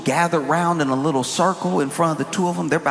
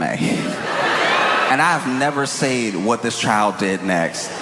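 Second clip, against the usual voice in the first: ordinary talk, nothing marked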